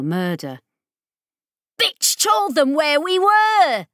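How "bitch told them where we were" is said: high, loud, slow furious howl. Acted. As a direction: howl it in fury. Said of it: "howled from her speakers".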